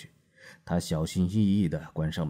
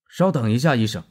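higher and louder, stopping him cold; forceful command; 7 semitones higher, 11 dB louder than usual. A narrator's reading. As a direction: bark a hard halt. Marlowe blocks the doctor's way.

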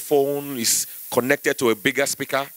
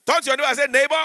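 high, lively preacher instruction; mock pastor call; 10 semitones higher, faster than usual. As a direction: play the pastor leading church.

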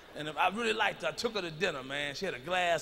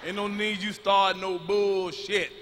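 1 slow placating; 5 dB louder, much slower than usual. Retold or imitated. Calming angry wife.

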